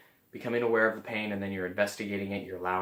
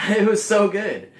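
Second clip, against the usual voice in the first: laughing joy; 9 semitones higher, 12 dB louder than usual. Real, not acted. Joyful outburst about meeting.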